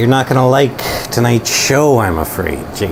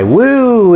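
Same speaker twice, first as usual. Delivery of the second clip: high, drawn-out woo; long sliding woo, falling away; mock cheer; 13 semitones higher, 4 dB louder than usual. Streamer cheering his own intro, drawn-out woo.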